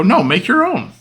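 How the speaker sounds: high-pitched, indignant refusal; firm refusal. Retold or imitated. Older sibling refusing brother the plate, indignant.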